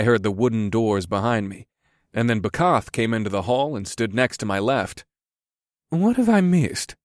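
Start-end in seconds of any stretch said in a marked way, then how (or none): none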